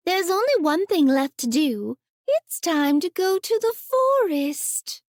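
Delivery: put-on high, hesitant child's voice; a small girl nervously deciding; uneasy little girl. Little girl deciding to go into the forest, uneasy. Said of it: "Sasha decided uneasily".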